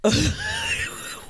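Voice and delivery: sharp high mock gasp; shocked gasp. Mom turns to her farting toddler, gasping. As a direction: gasp in wide-eyed shock. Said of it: "I just looked at her like".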